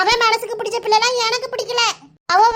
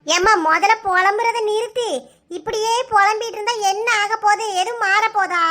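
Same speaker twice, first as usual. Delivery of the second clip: normal talking voice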